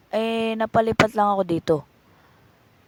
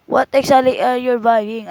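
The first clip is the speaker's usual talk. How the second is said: exasperated; 3 semitones higher, 9 dB louder than usual. Acted. Frustrated vendor.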